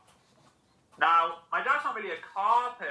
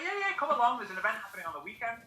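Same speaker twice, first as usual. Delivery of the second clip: high, fast, upbeat salesman; eager recruiter; 7 semitones higher, 4 dB quieter, faster than usual. Retold or imitated. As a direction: pitch it cheerily and quick. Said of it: "He was like".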